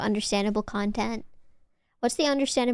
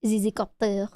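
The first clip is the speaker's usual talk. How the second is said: French-accented pronunciation of "Zizzycopter"; "Zizzycopter" with French vowels. Shown as a demonstration. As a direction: say "Zizzycopter" as a French speaker would. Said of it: "any Frenchies in the chat".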